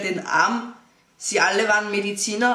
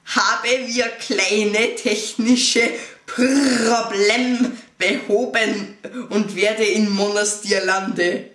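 broken German pilot announcement; 2 semitones higher, 4 dB louder, much slower than usual. Retold or imitated.

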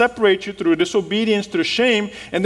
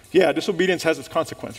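ordinary talk, nothing marked

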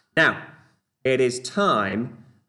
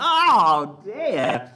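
high, loud exasperated oh dear; 8 semitones higher, 5 dB louder than usual. Real, not acted. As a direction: squeal at your own slip. Trips over tongue twister.

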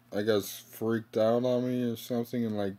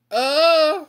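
sudden high-pitched squeal; 11 dB louder than usual. Real, not acted. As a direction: let out a shrill squeak. Outburst after begging for likes.

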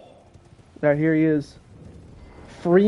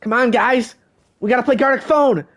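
imitating a pushy friend; 6 semitones higher than usual. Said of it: "he'll be like".

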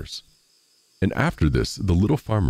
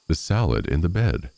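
ordinary delivery, nothing marked

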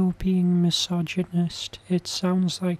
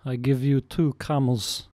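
broken-English troll voice, lightly Russian-sounding; a troll hamming up a thick foreign accent; mock foreign accent; 5 semitones lower, 3 dB quieter than usual. A troll propositioning a woman in a mock foreign accent.

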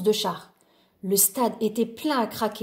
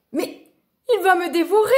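high, loud panicked cry; terrified cry; 7 semitones higher, 6 dB louder than usual. Acted. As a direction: shout in fear of being eaten. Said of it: "cria Loup terrifié".

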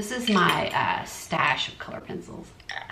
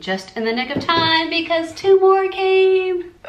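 excited squeal; 12 semitones higher, 9 dB louder, slower than usual. Real, not acted. Delighted new sketchbooks arrived.

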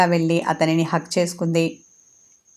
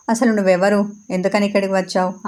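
usual speaking voice

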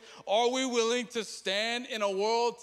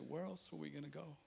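hushed reply; 5 semitones lower, 16 dB quieter, faster than usual. Acted. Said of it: "And they say".

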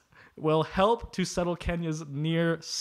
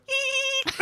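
high squealing hyena shriek; hyena laugh impression. Shown as a demonstration. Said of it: "my best impersonation of a hyena laugh".

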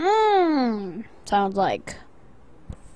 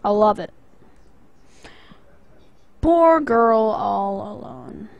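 normal talking voice

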